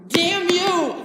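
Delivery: high, loud outburst; furious shout. Acted. Man cursing the pup he killed, furious shout. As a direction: yell it in anguished anger. Lenny curses the dead pup.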